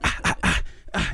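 breathy ah ah as the knee wobbles; panicked gasps. Retold or imitated. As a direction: gasp like you're about to fall. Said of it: "It's like".

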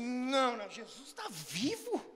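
slow, astonished question with a swooping rise; stunned disbelief. Retold or imitated. Stranger learning that Jesus is alive, stunned. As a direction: draw it out in amazed doubt.

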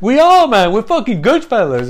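high, loud buddy hype; fired-up agreement, pitched well up; excited agreement. Retold or imitated. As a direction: hype up the gang. Acted excited agreement among the gang.

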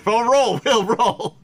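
high, loud, eager call to roll; excited urging. Real, not acted. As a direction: egg the player on to roll the dice. Urging player to roll dice.

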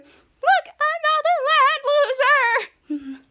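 high-pitched, loud creature taunt; high mocking character voice; jeering mole person. Mole person jeering in retold show.

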